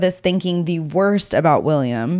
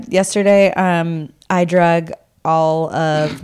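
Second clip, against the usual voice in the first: usual speaking voice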